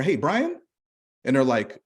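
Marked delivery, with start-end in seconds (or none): 0.0-0.6 high rising familiar ask; casual questioning. Shown as a demonstration. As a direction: say it like you know him.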